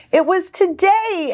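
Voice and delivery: ordinary delivery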